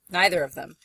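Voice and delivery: ordinary talk, nothing marked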